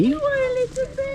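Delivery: put-on high, loud cooing baby talk. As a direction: gush over the little baby.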